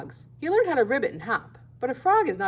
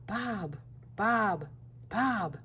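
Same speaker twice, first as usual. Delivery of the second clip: much softer hushed repeat; whispered; 8 dB quieter, much slower than usual. A narrator's reading. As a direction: hush the name, scared. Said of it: "Bob whispered".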